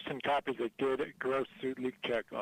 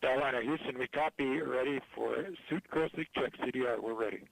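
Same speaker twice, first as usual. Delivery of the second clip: mission control over a radio link; compressed, tinny radio call; space radio; 2 semitones lower than usual. Real, not acted. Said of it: "that call there".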